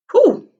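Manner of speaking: a high amazed whew. Acted. Teacher wowed by the flying car, high whew. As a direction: breathe out a big high whew.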